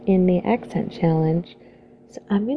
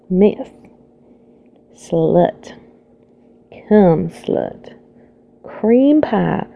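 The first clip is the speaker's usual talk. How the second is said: sexy Southern belle. Acted. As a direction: read each item as a playful Southern belle. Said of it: "to do a sexy Southern belle".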